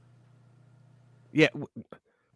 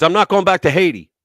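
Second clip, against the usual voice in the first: louder, defiant imitation of the man; half-hearted mock yell; mock defiant shout; 4 semitones higher, 9 dB louder than usual. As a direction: belt it like a stubborn protester. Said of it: "He goes".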